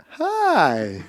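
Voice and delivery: high put-on "hi"; a high-pitched mock greeting. Actor greets the stuntman he is introduced to, put-on high hello.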